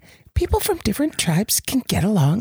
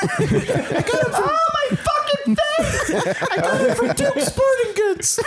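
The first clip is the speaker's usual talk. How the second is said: high yelling through laughter; cracking up while shouting; laughing shout. Real, not acted. Joking answer, laughing shout.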